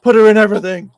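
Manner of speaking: high, loud mock order; mock demand. Friend imitates exec insisting on casting, high loud. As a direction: bark the demand, hammed-up. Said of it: "It's just like".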